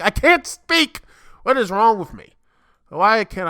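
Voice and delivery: high, loud burst of self-frustration; exasperated at himself. Real, not acted. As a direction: cry out at your own tongue-tie. Exasperated after stumbling over a word.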